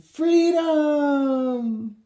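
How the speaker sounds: high, loud joyful scream. Acted. Girl cheering the freed hawk, high joyful scream. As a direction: shout it to the sky as the bird flies off. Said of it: "Emma screamed".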